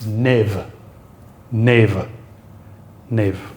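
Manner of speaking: "neve" as a single syllable, nehv; falling one-syllable "neve", no split; one merged vowel. Shown as a demonstration. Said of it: "How would that sound?".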